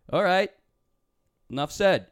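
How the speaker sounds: put-on older brother ending the talk; curt dismissal. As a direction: shut it down with a loud curt line. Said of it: "He goes".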